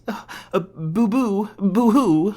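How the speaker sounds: high, hammed-up fake blubbering; mock crying. Captor taunting the prisoner with fake tears. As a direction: pretend to sob like a baby. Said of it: "All the tears running down it?".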